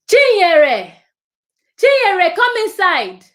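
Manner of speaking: high, loud call across the garden. Acted. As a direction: shout her name from the doorway. Adult calling child from house.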